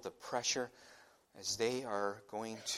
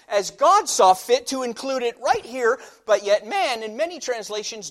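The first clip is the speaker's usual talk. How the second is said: loud, high fiery preaching; preacher scolding translators for cutting the pronoun; preaching at full force; 11 semitones higher, 12 dB louder than usual. Acted. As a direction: press it home with rising fire.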